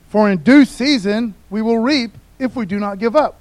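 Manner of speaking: loud, declaimed Bible verse; emphatic pulpit reading; scripture reading. Acted. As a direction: read the verse out with force. Reads scripture aloud at force.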